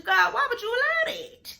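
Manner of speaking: high, crying plea, half acted; pleading cry to God. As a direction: wail the question up to God like a hurt child. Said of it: "let him know your frustrations".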